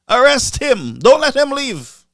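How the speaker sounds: high, loud mock order; mocking the speaker. Mocking the speaker ordering police, high loud mock command. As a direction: command the police with pompous authority. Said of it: "The speaker said".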